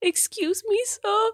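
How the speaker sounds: put-on high, small four-year-old voice; lost little kid in a grocery store; baby voice. Lost four-year-old asks a stranger for help, high baby voice. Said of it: "I don't wanna talk like a baby".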